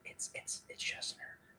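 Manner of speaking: hushed whisper, breath only. Real, not acted. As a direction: whisper it like a secret. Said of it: "Why are you whispering that".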